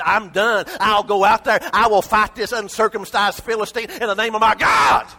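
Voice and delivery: loud, high declaration of battle; David's shouted vow against the giant; defiant shout. Retold or imitated. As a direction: cry it out like a young warrior.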